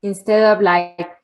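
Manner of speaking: ordinary talk, nothing marked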